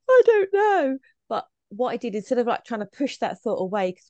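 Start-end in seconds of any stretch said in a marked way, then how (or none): 0.1-1.0 loud, very high mock-panic cry; comic high-pitched freak-out; panicked wail. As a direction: wail it as if fleeing in hammed-up panic. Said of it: "gone running in the toilets going".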